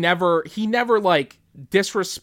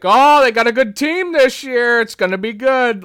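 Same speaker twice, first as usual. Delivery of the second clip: high, loud sports-fan enthusiasm; booming upbeat fan talk; Tom Atkins impression; 9 semitones higher, 8 dB louder than usual. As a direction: cheer on the team with gusto. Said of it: "inflection is so spot on for him".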